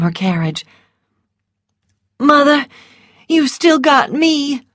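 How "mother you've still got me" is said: put-on higher-pitched boy's voice, earnest; earnest young son. A narrator's reading. Phil comforts his bereaved mother, earnest boy's voice. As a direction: plead with your mother as her remaining son.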